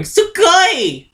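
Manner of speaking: squealed high anime gush; excited anime fan. Pretend. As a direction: gush like a hyper weeb. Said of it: "being a massive weeb and going".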